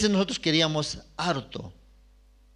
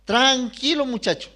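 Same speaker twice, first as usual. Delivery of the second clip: put-on higher, louder soothing old woman; grandmother calming; 9 semitones higher, 5 dB louder than usual. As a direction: settle the boy down. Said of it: "¿qué decía?".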